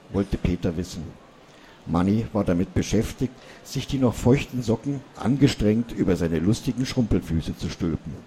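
usual speaking voice

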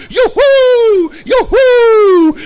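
long, high, loud "yoo-hoo" whoops; jubilant whoop. A narrator's reading. Children racing skateboards through an empty hall, jubilant whooping. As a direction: whoop with abandon, holding the last vowel. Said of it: "düste jauchzend los".

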